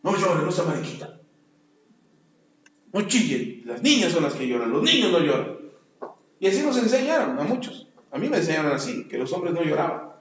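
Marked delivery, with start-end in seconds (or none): 0.0-5.6 scolding parent. Retold or imitated. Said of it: "les dijeron o les enseñaron".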